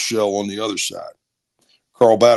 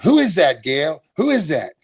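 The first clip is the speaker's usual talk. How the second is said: lightly imitated mom, pitched up; higher voice of a questioning mother; mother in background; 5 semitones higher than usual. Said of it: "I could hear my mother in the background".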